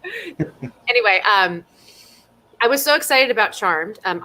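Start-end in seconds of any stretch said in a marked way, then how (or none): 0.0-0.7 higher, laughing mock nag; mock scolding. Teasing the host about late pasta, laughing mock scold. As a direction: tease him like a nagging mom.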